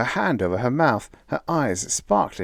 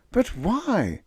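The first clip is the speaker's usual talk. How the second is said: higher, worried and pleading; anxious question; 14 semitones higher than usual. A narrator's reading. Molly anxiously asking Bill while running, higher. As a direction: ask with rising anxiety. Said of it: "she asked anxiously".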